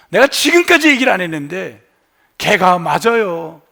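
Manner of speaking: loud, high-pitched outburst at mother; angry son. Acted. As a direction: act out a son's pent-up anger bursting out. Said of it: "그러면서 막 화를 내는 거예요".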